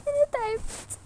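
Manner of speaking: high, soft tearful plea; weak, high-pitched crying voice. Acted. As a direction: whimper it softly through tears. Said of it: "مالك بس بتعيطي ليه".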